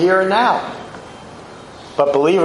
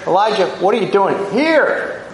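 HERE stretched and swooping high at the end; stress on HERE; 4 semitones higher than usual. Shown as a demonstration. God asks Elijah why he is at Horeb, stress on HERE. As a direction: save the big lift for the word HERE. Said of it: "Or he could have been saying".

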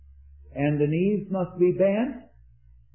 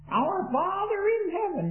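much higher, nasal, feminine prayer voice; high-pitched nasal voice; 14 semitones higher than usual. Retold or imitated. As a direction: imitate a big man praying in a thin high nasal voice. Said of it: "in a high-pitched nasal voice he began".

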